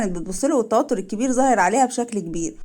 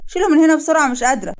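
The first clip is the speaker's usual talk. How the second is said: louder, higher urgent order; insistent disgust; 5 semitones higher, 4 dB louder than usual. Acted. Wife who cannot bear the smell, loud urgent order. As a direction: push it out sharply, raised and insistent.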